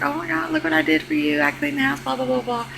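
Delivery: high mock-excited man voice; high mock boast. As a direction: imitate a proud boyfriend, high. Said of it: "They're like".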